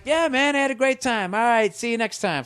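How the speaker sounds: sing-song fake politeness, biting back annoyance; fake polite reply. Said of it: "And it's just like".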